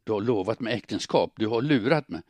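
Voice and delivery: whispered then rising to a hurt cry; betrayed woman's outburst; wounded accusation. Acted. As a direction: accuse him, voice breaking loose. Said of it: "sa hon".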